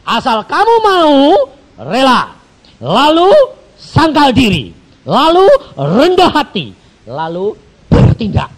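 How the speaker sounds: loud, high preaching; full-force preaching. Real, not acted. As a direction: hammer each step home. (khotbah berapi-api, keras dan tinggi)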